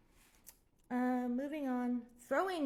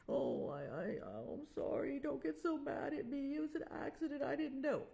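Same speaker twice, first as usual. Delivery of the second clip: mocking whiny ex; 3 semitones higher than usual. Ex pretending innocence to his girlfriend, whiny mock plea. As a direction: play the ex whining that it was an accident. Ex pleading after hurting her.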